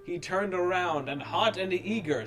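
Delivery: ordinary delivery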